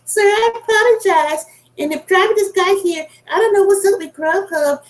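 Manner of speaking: high-pitched mocking put-on voice; mimicked apologetic excuse. Mimics staff excuses to customer.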